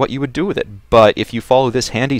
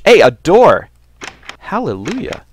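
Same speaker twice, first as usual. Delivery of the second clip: excited; 8 semitones higher, 8 dB louder than usual. Real, not acted. Finds a door at last.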